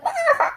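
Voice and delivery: high mock guffaw; imitated mocking laugh. Guy laughing at her under the car, high imitated laugh. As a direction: imitate someone pointing and laughing. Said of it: "totally laughing".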